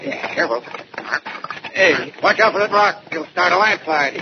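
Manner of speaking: high, urgent warning call. Acted. Officer warning partner on the steep cliff. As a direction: call up the slope to him.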